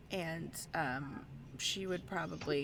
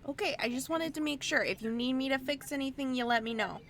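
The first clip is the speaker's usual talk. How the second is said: high, quick Midwestern chatter; eager helpful Midwestern tinkerer; Midwestern accent; 7 semitones higher, faster than usual. Acted. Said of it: "a Midwestern accent".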